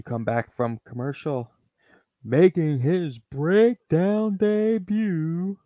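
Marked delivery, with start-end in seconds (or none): 2.2-5.6 ring announcer. Acted. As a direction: stretch every word like an arena announcer. Ring announcer introduces debut.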